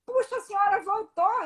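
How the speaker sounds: surprised delight. Retold or imitated. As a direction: imitate someone amazed to see her back. Retelling cashier amazed at her return.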